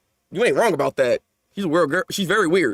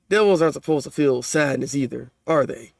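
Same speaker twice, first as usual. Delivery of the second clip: ordinary delivery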